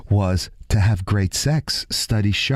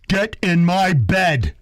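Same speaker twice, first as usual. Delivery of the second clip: Ronnie Camaraeri; 10 semitones higher, slower than usual. Retold or imitated. Acting Ronnie's line to Cher.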